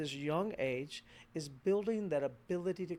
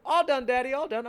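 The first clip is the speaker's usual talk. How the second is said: put-on toddler voice; 7 semitones higher, 9 dB louder than usual. Toddler calling back down hall.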